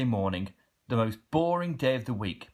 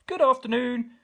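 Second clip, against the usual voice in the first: high, cheery greeting; cheery postman; 12 semitones higher, 4 dB louder than usual. A narrator's reading. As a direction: chirp a bright hello.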